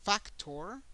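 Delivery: English "factor", first syllable stressed. Shown as a demonstration. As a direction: punch FAC in "factor", English style. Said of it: "an emphasis in English in the first syllable".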